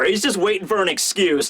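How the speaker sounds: usual speaking voice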